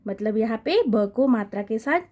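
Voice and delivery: normal talking voice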